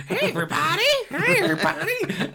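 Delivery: very high, loud mimicked hello; hammed-up squeal of a celebrity greeting, laughing. Star greeting the whole shop staff, hammed-up high impression. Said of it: "she was so like that".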